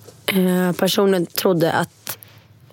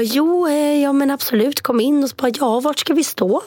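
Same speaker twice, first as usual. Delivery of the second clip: flustered politeness; 6 semitones higher than usual. Retold or imitated. Greeting the hotel managers in a robe, flustered high politeness. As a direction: fake brightness while still drunk.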